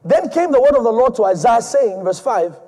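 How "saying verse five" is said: ordinary talk, nothing marked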